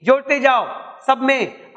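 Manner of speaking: usual speaking voice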